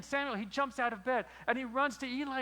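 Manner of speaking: normal talking voice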